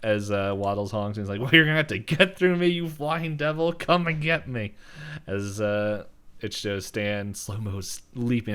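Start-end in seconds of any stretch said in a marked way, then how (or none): 0.0-4.7 raised-pitch mock yell; retold yelling. Stan challenges the pterodactyl, mock defiant yell. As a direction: taunt a monster, louder and higher, imitated. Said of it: "yells at the pterodactyl".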